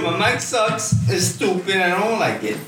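high mock-whiny complaint; pouty, put-on grumbling; mock whining. Mock complaint about own mic.

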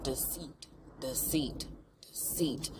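hushed, low, drawn-out whispered chant; eerie whisper. Retold or imitated. Man whispering his accusation in a spooky hush. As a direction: breathe it out like a spell.

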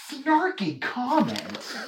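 snarky movie-star parody, very slow and high; Ryan Reynolds impression. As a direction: stretch it out into a mock wisecrack. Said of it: "My official impression of Ryan Reynolds is this".

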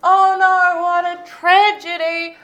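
high, slow, hammed-up false dismay; mock tragedy. [name] feigning dismay at her ruined dress, hammed-up and high. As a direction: pretend to mourn the dress, delighted.

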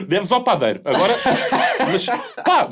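talking through laughter; high, loud and laughing. Real, not acted. Friends joking, laughing mid-sentence.

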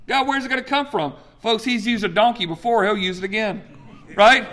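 usual speaking voice

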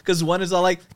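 ordinary talk

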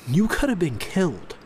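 lightly voiced worried mom; mother scolding. A narrator's reading. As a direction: scare sense into him.

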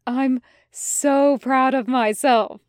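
slow gasp of helpless mirth; mildly choked-up, drawn-out pride; gasping, nearly in tears. A narrator's reading. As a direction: gasp it out, overcome with glee. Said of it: "Tim gasped, nearly in tears".

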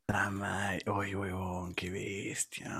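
whispered curse; cursing under breath. Real, not acted. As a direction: swear it under your breath.

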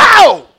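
loud, high-pitched sudden holler; re-enacted yell. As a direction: cry out as if alone in the car. Re-enacts yelling in the tunnel.

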